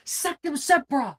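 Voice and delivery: high, urgent incantation; spell call-out. Acted. Racer casts a spell in the tunnel, high call-out. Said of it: "call out".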